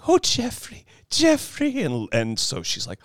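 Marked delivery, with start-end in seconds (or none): 0.1-1.8 anguished Laura Dern. Retold or imitated. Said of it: "she's going".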